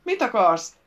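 loud, high shouted demand; shouting back. A narrator's reading. King Vincent calls back to his captor, shouting. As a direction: fire the question back loudly. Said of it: "kiáltott vissza Vincent".

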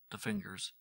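ordinary delivery, nothing marked

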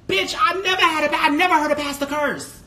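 loud, high-pitched disbelief; scandalized outburst. Real, not acted. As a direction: exclaim it in indignant shock. Indignant shock at a cursing pastor.

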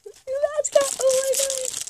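high excited squeal; shrieking jackpot winner. Real, not acted. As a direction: squeal like you won the jackpot. Thrilled reveal of new toy.

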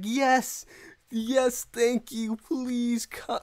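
high-pitched, overjoyed thanks; eager acceptance. Retold or imitated. Gamer retelling accepting a new player's offer, high and eager. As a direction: jump at the offer, pleading and eager. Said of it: "I was like".

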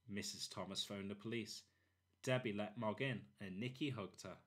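ordinary delivery

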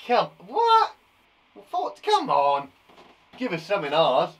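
loud, hammed-up protest; put-on outraged heckle; mock scoffing pupil. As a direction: scoff at how easy it is, loudly. Mimics pupils scoffing at easy question.

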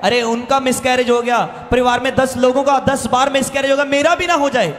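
ordinary delivery

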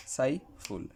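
"সাইফুল" as two spaced beats, সাই, ফুল; one breath pulse each for সাই and ফুল in "সাইফুল"; syllable by syllable. Shown as a demonstration. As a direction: say "সাইফুল" with a gap between its syllables. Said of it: "এক শ্বাসে".

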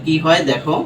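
ordinary talk

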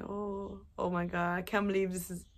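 very soft, awed murmur to yourself; hushed disbelief. Retold or imitated. Vlogger reliving staring into the eye, hushed disbelief. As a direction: drop right down, quietly stunned. Retelling own stunned reaction to eye.

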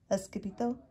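ordinary delivery

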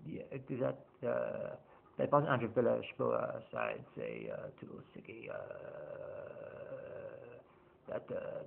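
badly faked manager impression, very soft and halting; Andrew Villas-Boas. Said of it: "I can't do the impression of Andrew Villas-Boas".

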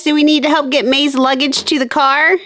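normal talking voice

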